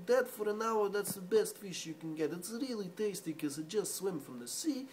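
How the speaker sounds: Russian fishmonger accent. Shown as a demonstration. Russian fisherman praising dead fish, Russian accent. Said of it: "Russian is one of my favorite accents".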